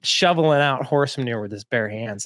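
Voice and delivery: normal talking voice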